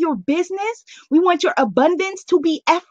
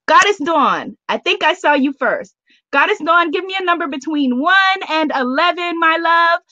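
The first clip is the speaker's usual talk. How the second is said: ordinary talk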